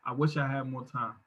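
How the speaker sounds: quiet, low mild tearful echo; barely weeping the words; retold crying. Son retells his dying father crying, softly.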